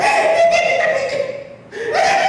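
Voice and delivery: high, drawn-out mock toddler giggle; imitated toddler squeal. Son spotting shoes at Payless, imitated gleeful squeal. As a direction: squeal like an excited little boy. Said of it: "my son is going".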